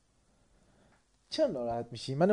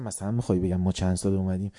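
calmly soothing; 5 semitones lower, 6 dB quieter, faster than usual. Retold or imitated.

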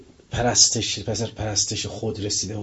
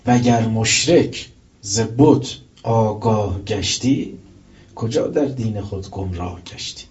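slow, measured verse reading; slow recited Persian verse; poem recitation; 4 dB louder, slower than usual. Acted. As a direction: recite the couplet slowly and deliberately. Continues the couplet.

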